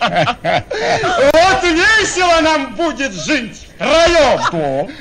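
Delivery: laughing through the words; bubbling over with laughter. Acted. Servant laughs to his new bride, delighted. Cheerful betrothal banter.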